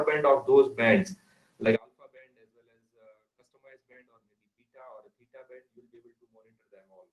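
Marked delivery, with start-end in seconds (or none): 1.8-7.1 faint, distant microphone; barely audible. Real, not acted. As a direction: voice fading far away. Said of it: "Your voice is very low".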